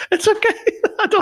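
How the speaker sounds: high, laughing reassurance. Real, not acted. Host reassuring guest after a joke, laughing. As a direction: laugh through the words.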